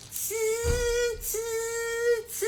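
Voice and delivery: high, soft teasing tss sounds; monkey tss. Acted. Monkeys mocking the peddler back, high little tss sounds. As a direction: tut back like cheeky monkeys. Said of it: "shook both their hands back and said".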